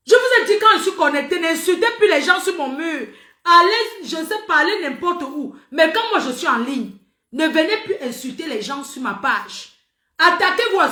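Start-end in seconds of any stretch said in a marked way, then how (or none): 0.1-5.6 loud, high, forceful order; angry telling-off. Real, not acted. Streamer ordering followers to stop insulting on her wall, angry telling-off. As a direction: lay down the law, voice raised.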